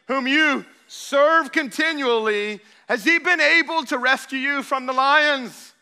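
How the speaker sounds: loud, high, drawn-out cry of a desperate king; anguished call. A narrator's reading. King calls down into the lions' den, anguished and loud. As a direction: call out in anguish, slow on has your God. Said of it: "he called to Daniel in an anguished voice".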